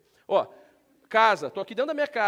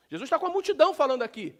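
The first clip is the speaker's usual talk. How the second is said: ordinary talk, nothing marked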